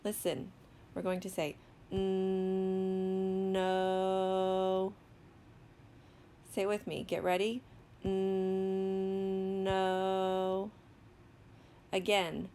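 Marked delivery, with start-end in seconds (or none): 1.9-4.9 "N-, no" with a long hummed n gliding into a long o; slow sounding-out. Shown as a demonstration. Teacher models sounding out a word, slowly. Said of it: "say the word slowly without stopping". 8.0-10.7 "N-, no" with a long hummed n gliding into a long o; slow sounding-out. Shown as a demonstration. Teacher models sounding out a word, slowly. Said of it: "say the word slowly without stopping".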